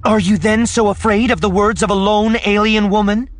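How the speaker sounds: very high, loud challenge; defiant taunt. Acted. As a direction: taunt the ruler before the whole crowd. Leia taunts the dynast publicly.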